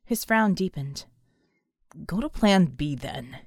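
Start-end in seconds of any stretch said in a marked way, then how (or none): 2.0-3.4 growled order. A narrator's reading. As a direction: growl it under your breath. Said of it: "he growled".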